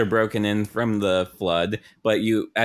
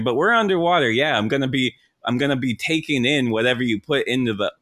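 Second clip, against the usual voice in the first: ordinary talk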